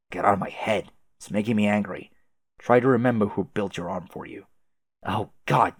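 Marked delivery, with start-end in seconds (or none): none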